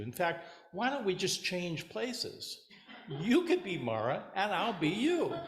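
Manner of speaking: a demon at wits' end with his meditating army; exasperated Mara. Acted. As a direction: Mara venting to Buddha, rising to exasperated highs. Mara complaining about his army.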